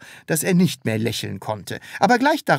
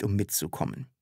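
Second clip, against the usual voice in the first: plain reading voice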